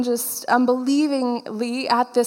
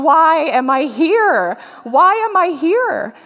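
loud, slow, higher wail of self-doubt; mock panic; 5 semitones higher, 6 dB louder, slower than usual. Graphic designer among renowned preachers wondering why, mock panic. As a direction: stretch it out loudly in acted-out dismay.

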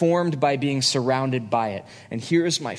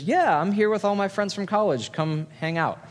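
breezy, higher offhand voice for the guy; a lightly voiced careless college guy; casual date guy; 7 semitones higher than usual. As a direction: toss it off like he doesn't care. Acting out the careless date's text.

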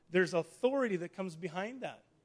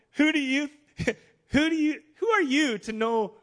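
loud, higher, astonished questioning; incredulous challenge; 7 semitones higher, 8 dB louder than usual. Acted. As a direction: demand it in disbelief, voice raised. Nathanael acted challenging Jesus' claim.

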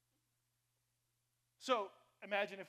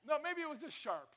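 high, louder protesting no; playful self-correction; 9 semitones higher, 6 dB louder than usual. Real, not acted. Pastor defending his own sharp remark, playfully high. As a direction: bounce it up in mock defense. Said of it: "that was not words of life".

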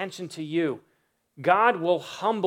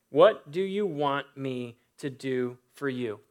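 slow, deliberate question; 3 semitones lower than usual. Acted. Preacher repeating Jesus' question to the blind man, slow and deliberate. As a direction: let each word land slowly.

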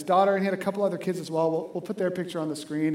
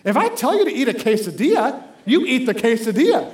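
louder, firm parent laying down the law; stern parent authority; 4 semitones higher, 7 dB louder than usual. Retold or imitated. Father insisting his son eat the quesadilla, firm and louder. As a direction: lean on your authority as a parent. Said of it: "like, my Jesus authority".

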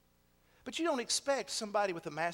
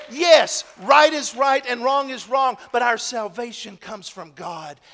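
emphatic affirmation; 7 semitones higher, 19 dB louder than usual. Acted. Affirmation in sermon.

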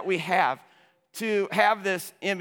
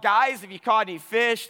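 raised-voice retold call; 5 semitones higher, 6 dB louder than usual. Jesus hailing the fishermen from shore, mildly yelled. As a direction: call out a little louder, as if to fishermen. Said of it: "he yells out".

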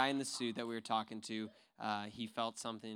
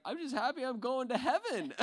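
high, laughing through the words; nervous laughing; 13 semitones higher, 8 dB louder than usual. Retold or imitated. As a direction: retell his giddy nervous chuckle. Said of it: "he was kinda laughing nervously".

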